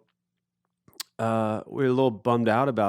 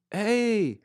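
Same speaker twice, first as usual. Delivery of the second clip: high, stretched hail; imitated calling out; 11 semitones higher than usual. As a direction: hail a passing boat, in imitation. [name] waving at passing boat.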